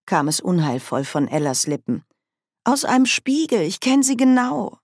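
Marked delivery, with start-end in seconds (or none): 2.6-4.8 put-on frightened young woman. A narrator's reading. Ella's frightened warning.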